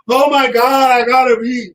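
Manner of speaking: hammed-up dismay at a B; mock panic. Niece freaking out over getting a B. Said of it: "She's like".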